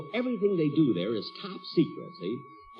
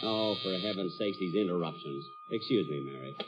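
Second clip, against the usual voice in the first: exasperated aside; 9 semitones lower, 7 dB quieter than usual. Acted. Jack annoyed at interruption.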